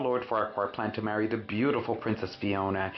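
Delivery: ordinary delivery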